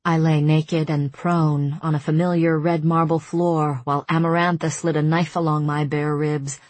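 plain reading voice

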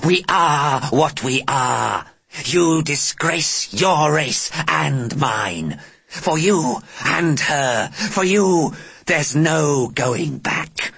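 high, slow, contemptuous alien voice; angry alien. Acted. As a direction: sneer it out slowly, alien menace.

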